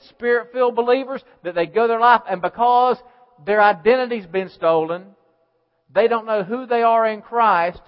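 loud, driving preacher's cry; fiery preaching. Acted. Pastor thundering at his church in full preaching voice. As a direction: preach it at full force to the congregation.

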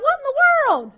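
high, loud retold exclamation; upset outburst. Peter baffled at Jesus's question, upset outcry. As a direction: burst out in upset disbelief. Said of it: "Peter got upset".

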